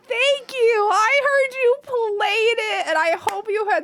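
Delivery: high, loud, drawn-out thanks; excited gratitude. Real, not acted. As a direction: gush thanks at a high pitch. Thanks a featured streamer.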